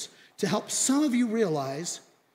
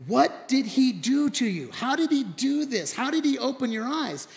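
drilling interrogation; 5 semitones higher than usual. Retold or imitated. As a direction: grill him like a prosecutor. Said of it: "the Pharisees drill in".

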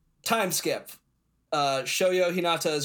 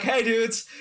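high, loud dude-bro greeting; Lev's dub voice; 8 semitones higher, 5 dB louder than usual. Retold or imitated. Describing a dub actor to a friend, imitated dude voice. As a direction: call it out like an upbeat dub character. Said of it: "his dub voice is like".

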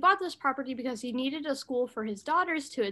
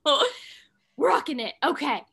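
ordinary talk

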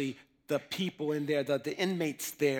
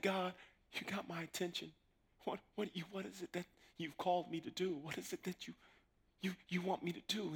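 hushed prayer; 3 semitones higher, 11 dB quieter than usual. Retold or imitated. Praying to God.

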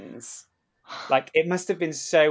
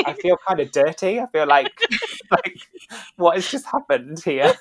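higher, louder, through laughter; amused mock dismay; 6 semitones higher, 9 dB louder than usual. Cast member snubbed by pin trader, laughing mock dismay.

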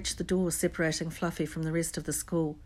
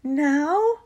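incredulous; 10 semitones higher, 10 dB louder than usual. A narrator's reading. Harry pressing urgently.

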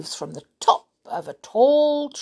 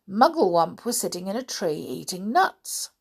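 plain reading voice

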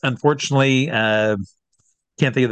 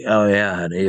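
put-on old bar lady; 3 semitones lower than usual. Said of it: "one of those old bar ladies".

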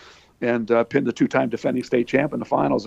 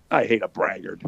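mock snarl.

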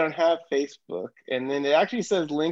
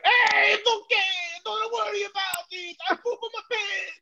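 very high cartoon Italian mom voice. Retelling a sketch where mom comforts her son, high mock Italian. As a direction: imitate a squawking Italian-caricature mother. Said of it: "that same delivery character going like".

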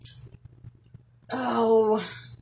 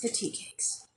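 breathy whispered echo, much softer; 13 dB quieter than usual. Real, not acted. Echoing the co-host's joke in a playful whisper. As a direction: hush it like a shared secret.

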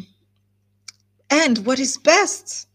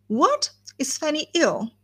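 high-pitched startled "What?"; sudden alarmed query in a retold scene; startled question; 7 semitones higher than usual. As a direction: jump up in alarm on the first word. Quoted startled exclamation.

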